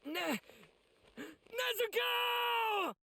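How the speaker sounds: drawn-out desperate scream of a name; long anguished shout, slowed right down; anguished cry. Acted. Brother cries out for sister.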